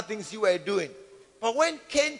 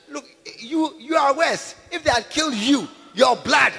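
usual speaking voice

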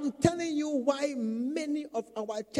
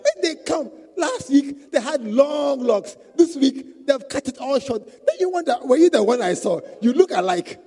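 emphatic preaching; 5 semitones higher, 8 dB louder than usual. Real, not acted. Preacher tells of hairstyles, louder.

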